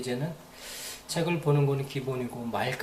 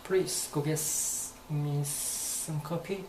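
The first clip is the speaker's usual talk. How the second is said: slow demo; 4 dB quieter, much slower than usual. Shown as a demonstration. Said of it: "me도 좀".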